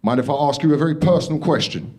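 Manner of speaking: lightly voiced bloke edging toward a nosy question; gym guy. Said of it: "He went".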